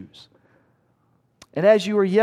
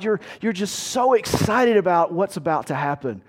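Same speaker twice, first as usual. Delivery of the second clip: ordinary talk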